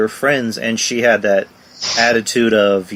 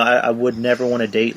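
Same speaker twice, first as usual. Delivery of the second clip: usual speaking voice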